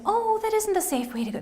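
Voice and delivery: put-on high, sing-song teacher voice for little kids; elementary school teacher voice. Shown as a demonstration. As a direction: gently coax a small child, high and sweet. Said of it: "This is my elementary school teacher voice".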